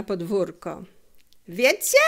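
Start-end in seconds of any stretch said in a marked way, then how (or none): 1.5-2.0 higher, louder call across the yard. A narrator's reading. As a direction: call to the boys playing. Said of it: "krzyknął Felek chłopakom". (głośne wołanie do chłopaków na podwórku)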